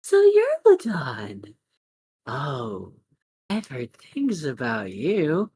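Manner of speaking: lilting feminine villain, unhurried. Acted. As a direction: drawl it slowly in a feminine pitch. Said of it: "feminine voice goes".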